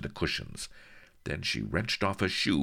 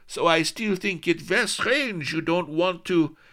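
coy Spanish lady; 11 semitones higher, 3 dB louder than usual. Acted. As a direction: tease him with a tilt. Lady coy then puzzled.

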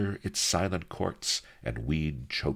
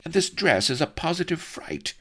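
much higher, fussing lady's voice; fretting noblewoman; 13 semitones higher than usual. A narrator's reading. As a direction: lament your appearance in a high ladylike cry. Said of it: "she cried to Blood".

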